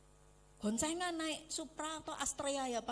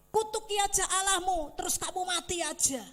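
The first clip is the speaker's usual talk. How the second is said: higher, louder mock scolding; imitating the bitter wife; Job's wife nagging; 7 semitones higher, 8 dB louder than usual. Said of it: "istrinya bilang apa".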